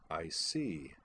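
understated skeptical reply; dubious. A narrator's reading. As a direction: say it quietly, not convinced. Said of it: "Cosway said dubiously".

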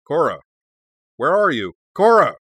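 loud, high-pitched shouts, rising to a yell; alarmed calling out. A narrator's reading. Tenzin calling for the missing Avatar, alarmed shouting. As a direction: call out for someone who has vanished.

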